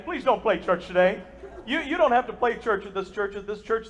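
usual speaking voice

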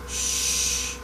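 whispered shush; hushing whisper. Retold or imitated. Quiet fisherman hushing his buddy, whispered. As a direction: hush them like a secret spot. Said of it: "When they get".

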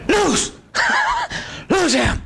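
high, sudden command; mimicked deliverance command. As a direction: imitate a preacher casting something out, high and abrupt. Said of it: "Like that".

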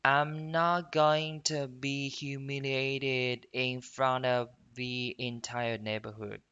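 slow, drawn-out model sentence; slow read-along. Shown as a demonstration. Teacher leading students to repeat, slow careful reading. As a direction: stretch each word evenly for learners to follow. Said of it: "我们来慢速跟读一遍".

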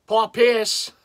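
loud put-on Boston accent, R's dropped; hammed-up Bostonian accent. Collector naming a Celtics player, mock Boston accent. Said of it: "try to say it with a Boston accent".

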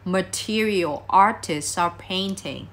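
slow model phrase, "artists are" run together as "arti-sar"; "material artists are painting" said slowly with the words linked; S linked across. Shown as a demonstration. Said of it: "Nối âm S sang".

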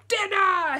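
put-on very loud, high baby call; baby demanding. Bigger baby shouting for dinner, high and loud. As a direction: bellow for dinner like a huge baby. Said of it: "he said".

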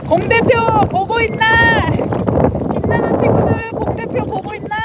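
high, loud playful call; excited call-out. Real, not acted. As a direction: shout it to your friend. Calling out to friend on camera.

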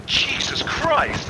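high, slow breathy Jesus Christ; mock shocked gasp. Retelling Hellraiser scene, guy's shocked gasp. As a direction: gasp it out in horror. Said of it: "Guy looks at him and goes".

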